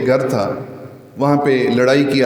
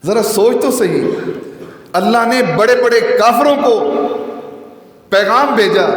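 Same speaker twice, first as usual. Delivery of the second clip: fiery pulpit delivery; impassioned preaching; 6 semitones higher than usual. Acted. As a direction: preach with rising passion.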